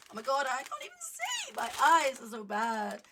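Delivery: loud, high wail about bad eyes; exasperated. Real, not acted. Cannot read the leaflet.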